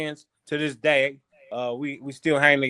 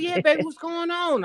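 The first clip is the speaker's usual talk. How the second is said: much higher squeaky auntie greeting; high-pitched auntie. Retold or imitated. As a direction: pitch way up like his old auntie. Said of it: "She, she got a real high-pitched voice".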